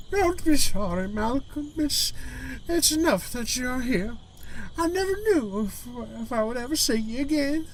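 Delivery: high, frail-grandmother character voice; female Gran voice. Acted. Gran welcomes Malcolm home with tears of joy, high grandmother voice. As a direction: play the tearful grandmother in a high put-on voice. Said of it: "a Scottish accent along with a female voice".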